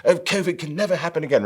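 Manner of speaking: mock Prince Charles. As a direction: send up a royal preaching about the planet. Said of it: "they got Prince Charles".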